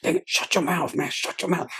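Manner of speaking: high, soft, half-whispered plea to shut up; urgent hushed warning. Retold or imitated. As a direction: hiss a frantic warning under the breath. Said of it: "I said".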